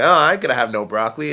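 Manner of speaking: put-on loud, quick kid-style protest; imitating younger self. Recalling being a kid refusing broccoli, mock protest. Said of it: "So I'd be like".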